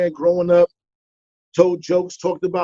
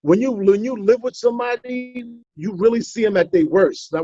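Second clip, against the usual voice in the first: ordinary talk, nothing marked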